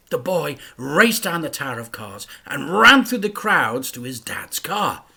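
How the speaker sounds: plain reading voice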